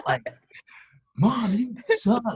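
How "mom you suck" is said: loud, high, drawn-out mock outrage; slow, laughing complaint to mom; playfully indignant shout; exasperated protest. Mock protest at mom's mild reaction.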